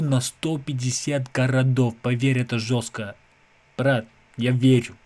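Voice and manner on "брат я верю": usual speaking voice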